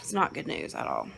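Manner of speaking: low, soft, defeated; weary sigh. Real, not acted. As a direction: let it sink in, deflated.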